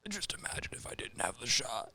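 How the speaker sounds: whispered, voiceless imitation; hushed mock-invalid whisper; mock sickly whisper. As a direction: whisper it like a sick person barely talking. Mimicking sick flu-shot people.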